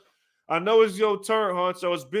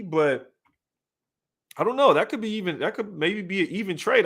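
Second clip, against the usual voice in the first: ordinary talk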